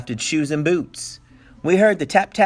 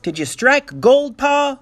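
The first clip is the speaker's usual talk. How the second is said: high, slow, hopeful question; eager children; 11 semitones higher, 4 dB louder, slower than usual. Acted. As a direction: ask breathlessly hoping for gold. Kids eagerly ask Pa.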